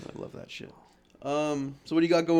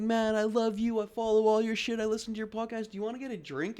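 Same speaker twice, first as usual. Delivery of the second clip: high-pitched eager fan voice; imitating a starstruck fan, pitched up; gushing fan; 8 semitones higher than usual. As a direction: gush like a fan after the show. Retold gushing fans after show.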